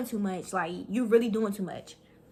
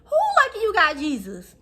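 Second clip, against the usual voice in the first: high, loud incredulous repeat; indignant; 7 semitones higher, 5 dB louder than usual. Retold or imitated. Repeating the rival's line to friends, high indignant. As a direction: throw her words back in disbelief.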